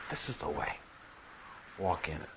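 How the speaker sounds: quiet, soft prompting from behind; hushed guiding voice. Acted. The Lord's voice behind you as you serve, hushed guidance.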